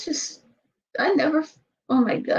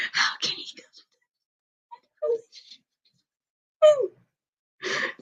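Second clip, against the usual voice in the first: soft unvoiced disbelief; shocked whisper; 12 semitones higher, 7 dB quieter than usual. Real, not acted. Watching the video alone, a stunned whisper. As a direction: breathe the question in stunned silence.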